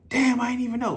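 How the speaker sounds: high, caught-off-guard friend; surprised friend. Retold or imitated. Friend learns the juice calories, high surprised. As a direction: play the friend realizing his mistake.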